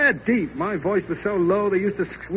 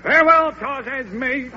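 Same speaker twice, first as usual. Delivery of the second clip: raised, louder melodrama goodbye; hammed-up farewell; 6 semitones higher, 5 dB louder than usual. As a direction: play the noble parting big. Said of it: "you be Stanislaus".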